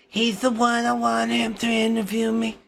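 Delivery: lightly voiced Trump talking about the reporter; bad Trump impression. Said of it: "My, my Trump is bad".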